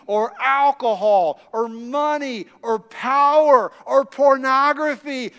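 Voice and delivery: loud, driving sermon delivery; fired-up preacher building to a climax; preaching at full force. Acted. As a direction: press the point home to the congregation.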